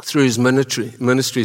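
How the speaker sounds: tongue sticking, words catching; dry mouth. Real, not acted. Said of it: "my tongue is sticking to the top of the lips".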